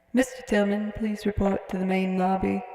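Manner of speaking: radio intercom announcement; lightly filtered lobby announcement. Shown as a demonstration. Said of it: "that radio voice in Mister Tillman".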